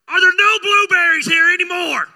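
furious yell demanding blueberries; angry shout. Retold or imitated. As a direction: shout it at the clerk in a rage. Mad, confronting the clerk.

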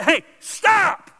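sharp loud call to stop; a loud, high "Hey, stop!"; firm shout. Pretend. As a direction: yell it like snapping someone out of it. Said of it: "you gotta come along and say".

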